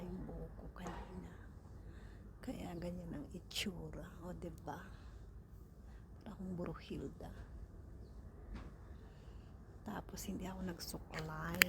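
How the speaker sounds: hushed, barely voiced asides; whispering. Real, not acted. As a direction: keep it down to a whisper. Hushed remarks at the bus stop. (pabulong na pagsasalita)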